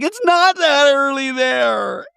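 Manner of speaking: high, stretched-out imitated wail; mock bawling. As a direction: cry it out in exaggerated despair. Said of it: "Brittany bawling".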